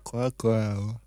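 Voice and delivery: talking round a pencil held in teeth; muffled words past a pencil in the mouth. Real, not acted. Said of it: "qu'est-ce que tu fais avec un crayon dans la bouche". (parler avec un crayon dans la bouche)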